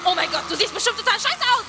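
high, hammed-up panic; mock-panicked inner monologue before a date. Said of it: "das klingt ungefähr so".

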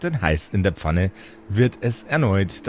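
ordinary talk

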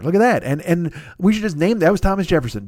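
ordinary talk, nothing marked

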